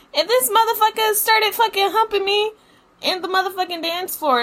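high-pitched shocked outrage; outraged. Real, not acted. Outraged retelling of humping.

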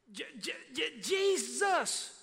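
stuttered build into a high, loud Jesus; excited shout. Real, not acted. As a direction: burst out with the name. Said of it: "The emphasis is clearly on".

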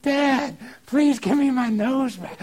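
put-on high, pleading little girl begging Dad, slow; tearful little girl. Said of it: "she got in tears".

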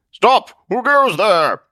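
high, loud challenge to an intruder; startled shout. Acted. Zealot catching an intruder, startled shout. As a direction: bark out a guard's challenge.

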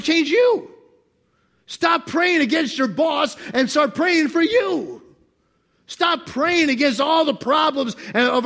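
high, loud preaching; full-force preaching. Acted. Preacher urging couples and workers, loud preaching. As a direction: drive it home to the congregation.

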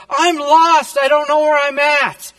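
loud, high-pitched panicked aunt; desperate. Retold or imitated. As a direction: wail it in mock desperation. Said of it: "And she's desperate".

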